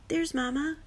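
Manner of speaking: put-on high little-child cry; excited cry. A narrator's reading. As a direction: call out high on spotting a parent. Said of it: "Kudzu cries".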